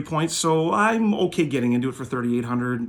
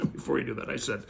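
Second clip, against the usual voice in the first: softer repeat through a laugh; 10 dB quieter than usual. Real, not acted. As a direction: chuckle as you repeat it.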